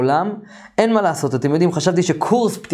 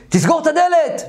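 retold shout; 12 semitones higher than usual. Alien yells at him to close the door, in a high mock shout. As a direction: act out yelling an order. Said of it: "והוא צעק עליי".

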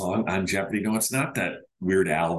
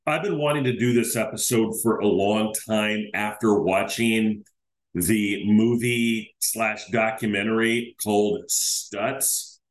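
ordinary delivery

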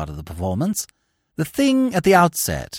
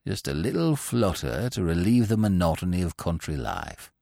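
lower, softer smug voice of a sly rival; a lower, quieter oily cad; 10 semitones lower, 8 dB quieter than usual. A narrator's reading. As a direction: drop low and purr it like a scheming bettor. Steggles voiced in narrated story.

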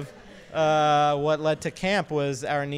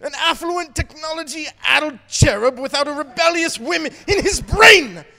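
higher, loud shouting British chef rant; Gordon Ramsay impression; 8 semitones higher, 9 dB louder than usual. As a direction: bark it like an angry TV chef. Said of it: "This is totally Gordon Ramsay".

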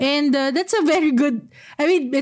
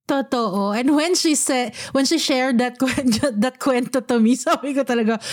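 ordinary delivery

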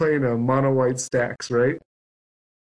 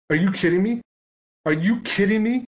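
imitating an incredulous opponent, pitched up; higher-pitched mock disbelief, question rising at the end; retold exasperation; 6 semitones higher than usual. Opponent to stalling player at three cards, exasperated.

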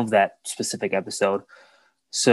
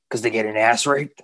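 put-on gravelly old man voice. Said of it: "that gravelly old voice man".

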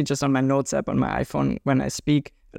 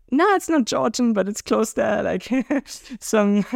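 imitating the stranger; 5 semitones higher than usual. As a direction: play the stranger with a laugh in the middle. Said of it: "And they're like".

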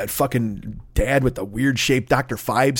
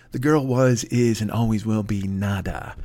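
put-on lower, softer, smug eighties rich-kid sneer; smug Spader villain; 5 semitones lower, 4 dB quieter than usual. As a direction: drawl it like the snobby villain from Pretty in Pink. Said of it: "Remember Spader's character?".

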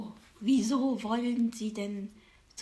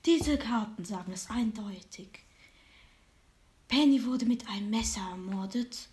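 fortune teller. Acted. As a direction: whisper it like a secret. Fortune teller reads cards.

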